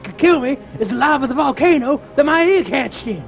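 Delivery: high, fast hammy train voice; Mister Torque voice. Acted. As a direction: rattle it off like a cartoon villain. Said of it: "I just sound like Mister Torque".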